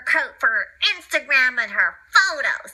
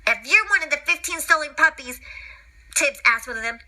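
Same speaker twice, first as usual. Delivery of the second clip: ordinary delivery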